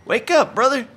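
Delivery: high, loud wake-up call. Pretend. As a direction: shout him awake. Calling to wake.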